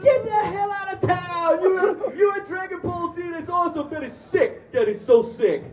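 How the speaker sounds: high-pitched New York fan shouting; excited accented stranger. Retold or imitated. Subway stranger learning they're on Dragon Ball Z, ecstatic shouting. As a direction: imitate a starstruck fan yelling at full tilt. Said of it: "people with that strong of an accent".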